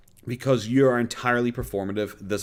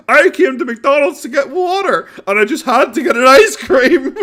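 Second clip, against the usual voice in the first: mocking him while laughing, much higher and louder; real laughter over a mock quote; laughing imitation; 12 dB louder than usual. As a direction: quote him through giggles. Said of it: "He's like".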